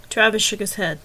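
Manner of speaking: usual speaking voice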